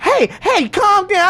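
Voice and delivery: high-pitched, loud mock intervening woman; passenger's woman. Woman calming the quarrel, high mimicked voice. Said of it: "So his woman was like".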